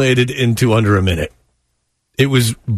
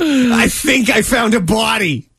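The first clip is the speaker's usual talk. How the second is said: mock Boston accent; 6 semitones higher, slower than usual. Mocking the reenacted emergency caller, slow hammed-up Boston accent. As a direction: draw out a bad Boston accent. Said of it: "to do a Boston accent".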